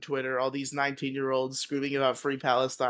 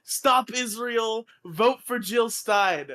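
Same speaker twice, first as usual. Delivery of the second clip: rally speech; 9 semitones higher, 4 dB louder than usual. Pretend. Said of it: "being like".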